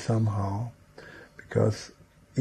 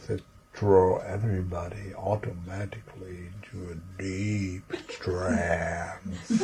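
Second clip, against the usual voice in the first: Milton Erickson trance voice; 3 semitones lower, 7 dB quieter than usual. Retold or imitated. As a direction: draw it out drowsily, sinking down into a deep trance. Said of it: "he had a kind of voice".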